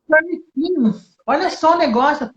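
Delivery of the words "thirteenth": Thirteenth with a clear th; thirteenth demo. Shown as a demonstration. Teacher modelling the word for the host, clear th. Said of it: "fala décimo terceiro".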